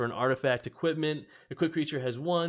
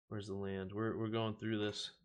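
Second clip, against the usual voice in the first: ordinary talk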